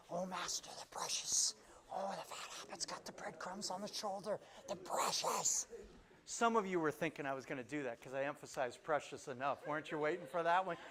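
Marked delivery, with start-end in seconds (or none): 0.1-5.7 raspy, fawning little creature. Acted. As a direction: wheeze it out like a creature obsessed with treasure. Creature impression in mid sermon.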